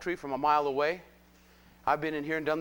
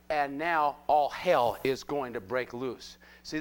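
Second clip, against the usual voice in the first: ordinary talk, nothing marked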